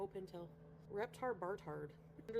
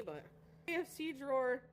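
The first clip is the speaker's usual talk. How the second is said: usual speaking voice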